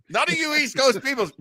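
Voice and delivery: usual speaking voice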